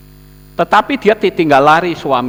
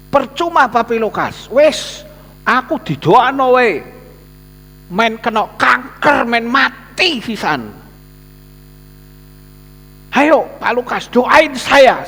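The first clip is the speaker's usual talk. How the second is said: desperate man begging; 9 semitones higher, slower than usual. Retold or imitated. Sick man begging the pastor to pray, high desperate plea. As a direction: cry out in despair for prayer.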